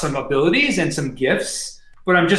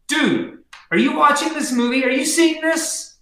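awestruck otherworldly entity; 10 semitones higher than usual. Acted. Entity asking a moviegoer about the vision, high and insistent. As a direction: call down in a high, stretched-out voice.

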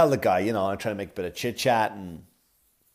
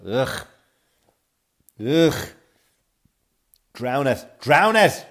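disgusted groan; 2 semitones higher, 5 dB louder, much slower than usual. Retold or imitated. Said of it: "I just go".

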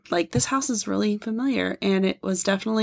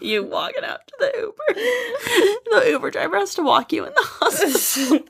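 laughing; 10 semitones higher than usual. Real, not acted. Laughing while picturing it.

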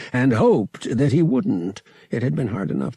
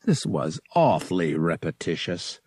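ordinary delivery, nothing marked

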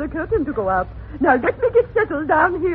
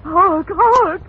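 insistent prompting call; urgent loud cue; 5 dB louder than usual. Acted. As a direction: hammer the cue to cover. Prompter cues desperately.

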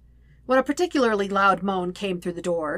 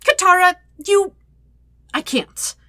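flustered, much higher and louder sputter; 11 semitones higher, 8 dB louder than usual. A narrator's reading. Embarrassed brother sputtering at his sister's tease. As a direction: choke on the words, flustered. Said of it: "Sokka sputtered".

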